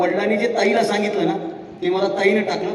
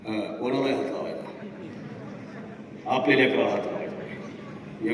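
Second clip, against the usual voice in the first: mocking threatening parent; 7 semitones lower, 9 dB quieter, slower than usual. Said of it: "बाप शाळेत जाऊन गुरुजीला म्हणते".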